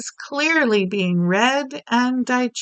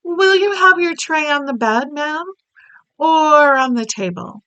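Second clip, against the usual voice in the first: maid's voice; 6 semitones higher, 5 dB louder than usual. Acted. Maid offering the breakfast tray.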